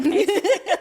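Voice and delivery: loud high laugh breaking out; sudden loud laugh; burst of laughter. Real, not acted. Laughs at own story.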